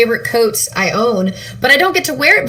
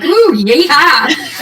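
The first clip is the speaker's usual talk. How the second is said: whooping, drawn-out southern yeehaw; southern twang; 5 semitones higher, 3 dB louder, much slower than usual. Acted. Player shows what the hat does to her, southern twang. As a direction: let the cowboy twang rip, higher and slow. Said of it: "I had to turn my accent off".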